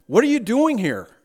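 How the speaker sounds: high, loud startled angel; incredulous angel. Acted. Angel questioning the thief at heaven's gate, incredulous. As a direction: play a gatekeeper who can't believe it. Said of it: "That's what the angel must have said".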